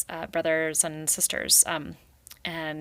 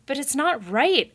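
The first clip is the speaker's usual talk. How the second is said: higher, louder pained cry; confused cry; 8 semitones higher, 6 dB louder than usual. Acted. Confused Rai crying out to the beaver. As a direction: blurt it out, bewildered. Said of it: "a confused Rai cried".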